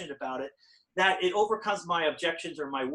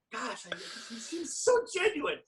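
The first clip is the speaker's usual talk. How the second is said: slow, higher naive voice; mock gullible self; 7 semitones higher, 4 dB quieter than usual. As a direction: play the easily fooled buyer. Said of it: "I was like".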